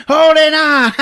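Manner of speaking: high, loud gleeful shout; hammed-up crazed cry; Old Greg. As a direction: yell it like a maniac clinging on. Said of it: "And he's like".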